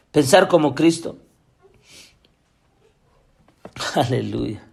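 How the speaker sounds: low, subdued preacher's appeal; hushed, low-pitched pulpit plea; solemn preaching appeal. Real, not acted. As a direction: drop the pitch well down and appeal gravely.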